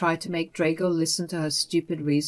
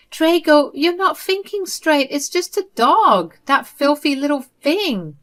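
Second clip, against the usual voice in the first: put-on higher, affronted older-mother voice; a mother offended at being spoken to this way; indignant mother; 9 semitones higher, 5 dB louder than usual. Mother scolding her son for his tone, higher indignant voice.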